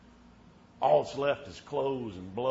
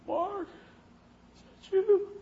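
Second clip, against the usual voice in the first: thin, much higher frail voice; a dying man barely calling out; weak little voice; 5 dB louder than usual. Retold or imitated. Dying friend on the phone from hospice, frail and high. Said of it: "this little voice".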